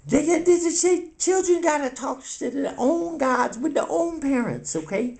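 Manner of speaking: high, indignant scolding; outraged scolding character. Acted. Castmate scolding Cookie about telling children of gods, indignant. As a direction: scold in a high voice, stretching the key words.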